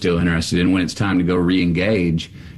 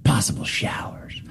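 whispered, much softer, with contempt; disgusted whisper; 5 dB quieter than usual. Retold or imitated. Weatherman mocks the editor's forecast in a whisper. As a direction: spit out the hated words under breath.

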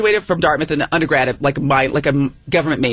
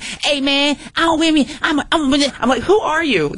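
much higher mock kid slang; imitating a kid; 10 semitones higher, slower than usual. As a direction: play a tiny kid acting tough. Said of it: "little dude's like".

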